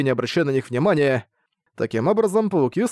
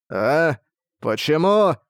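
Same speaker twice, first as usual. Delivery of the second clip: confused monster; much slower than usual. Acted.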